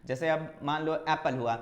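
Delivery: ordinary talk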